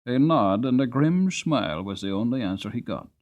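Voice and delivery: usual speaking voice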